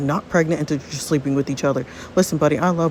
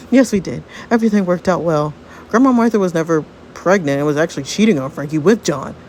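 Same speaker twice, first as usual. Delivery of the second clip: ordinary delivery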